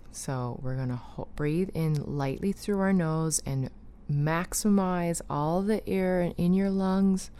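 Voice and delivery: breathing-exercise guide; guided breathing. Acted. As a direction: guide the breath in and out, whispering the release.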